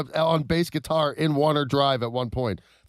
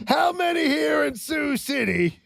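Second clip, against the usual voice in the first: high, slow rally shout; Trump shouting to crowd; 12 semitones higher, slower than usual. Retold or imitated. Trump fixing the city name at the rally, high slow shout. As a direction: bellow at the crowd to cover the slip.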